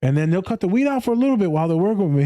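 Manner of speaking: ordinary talk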